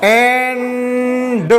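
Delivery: एंड stretched very slowly, every sound held; drawn-out phonics demo. Shown as a demonstration. Teacher to pupils, slowly sounding out एंड. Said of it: "एएनडी".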